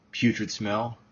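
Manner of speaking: plain reading voice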